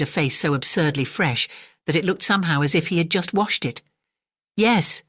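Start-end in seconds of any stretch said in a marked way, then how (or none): 4.6-5.0 higher, guileless yes; radiating innocence. A narrator's reading. Father Brown answers Merton, radiating innocence. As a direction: answer brightly, all innocence. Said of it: "he said, radiating innocence".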